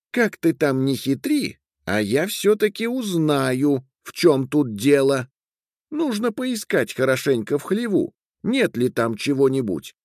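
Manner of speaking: high-pitched determined woman; scheming wife. Acted. Wife vowing to uncover her husband's secret, high and scheming. Voices Katri alone, plotting.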